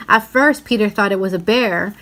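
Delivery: ordinary delivery, nothing marked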